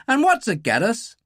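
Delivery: high-pitched, bitter Irish tirade; genuine anger. A narrator's reading. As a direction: rant bitterly, gathering speed at the end. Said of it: "genuine anger in his voice".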